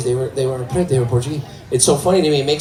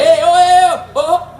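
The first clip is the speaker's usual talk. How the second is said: very high, loud comic hooting; hollering João; 13 semitones higher, 5 dB louder than usual. Acted. João from the back corner at graduation, high excited hollering. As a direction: hoot out a shrieky greeting.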